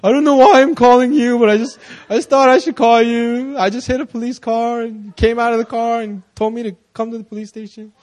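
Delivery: imitating a panicked caller, high-pitched; freaking out. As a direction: play a flustered, frantic phone call. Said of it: "freaking out".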